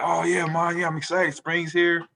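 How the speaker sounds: a coach cheerful that spring is here; excited coach. Retold or imitated. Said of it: "and he was excited".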